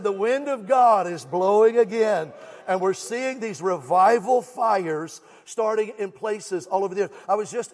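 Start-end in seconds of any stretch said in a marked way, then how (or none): 0.0-2.3 louder, slower preached repeat; emphatic repeat. Shown as a demonstration. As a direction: drive the line home for the church. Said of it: "I said".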